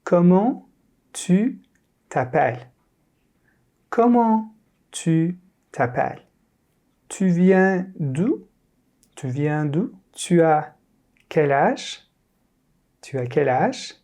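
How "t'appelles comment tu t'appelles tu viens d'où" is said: ordinary delivery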